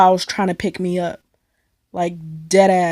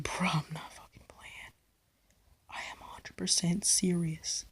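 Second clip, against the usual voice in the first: whispered, dead serious; hushed insistence; 3 semitones lower, 17 dB quieter than usual. Retold or imitated. Telling friends she nearly got kidnapped, whispered dead serious. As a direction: hiss it low so they finally believe you.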